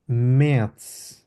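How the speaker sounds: মেয়াদস stretched slowly with ts ending; slow careful model of মেয়াদস; slow German demo. Shown as a demonstration. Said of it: "এসকে সেটের মতো উচ্চারণ হবে". (ধীরে মেয়াদস)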